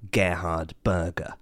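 slow, low, savoured Gerhard Berger; savouring the name. Shown as a demonstration. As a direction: roll Gerhard Berger out slowly with relish. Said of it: "enjoy saying the two words".

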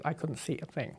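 drops to a breathy whisper; whispered aside. Real, not acted. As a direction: let it slip out under the breath. Blind without her glasses.

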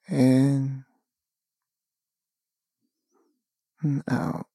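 calm, unhurried meditation voice with long pauses; slow, spacious relaxation guidance; guided meditation. Acted. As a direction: guide them gently into stillness, phrase by phrase.

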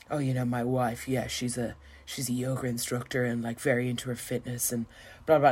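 low, soft humblebrag impression; bragging husband. Husband boasting about his wife on his podcast, imitated bragging. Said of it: "he was really bragging about her".

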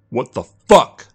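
loud, drawn-out furious outburst; spat in anger. A narrator's reading. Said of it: "Jack spat into the phone".

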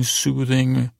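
ordinary talk, nothing marked

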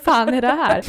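retold fright. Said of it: "Jag tänkte det var ett creep".

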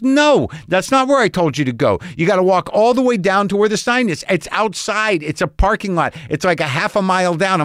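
high-pitched scolding directions, retold; exasperated correction. Rental clerk correcting a customer who went to the garage, exasperated.

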